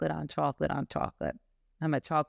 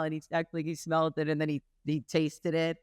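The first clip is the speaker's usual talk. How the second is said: ordinary delivery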